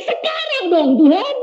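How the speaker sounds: high, eager begging; a mock-childish plea for right now; pleading. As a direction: whine it up to God like an impatient child. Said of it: "Saya bilang".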